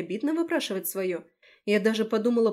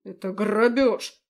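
loud, slow indignant cry; outraged shout; 7 dB louder, slower than usual. Acted. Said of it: "выкрикнул".

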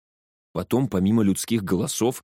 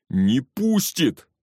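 higher, drawn-out call; urgent raised voice; 5 semitones higher, 3 dB louder, much slower than usual. A narrator's reading. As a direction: call it back from the front. Shout from the car's front.

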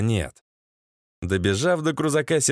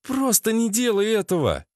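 high, insistent female voice; pleading woman; 9 semitones higher than usual. Acted. Lena asks Sasha to hold fire, high pleading. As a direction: plead in a raised pitch.

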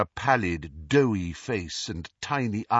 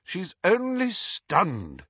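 impatient; 9 semitones higher, much slower than usual. A narrator's reading. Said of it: "said Professor McGonagall impatiently".

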